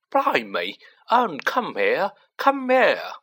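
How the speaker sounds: loud, climbing high shout of a cockney bus conductor; Stan shouting. A narrator's reading. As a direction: yell it across the street, rising to a peak. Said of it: "Stan shouted".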